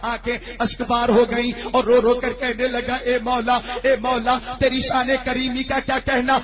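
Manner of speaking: rising, loud, high impassioned sermon; fiery preaching. Acted. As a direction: build the plea to God into a shout. Preaching climax, weeping plea.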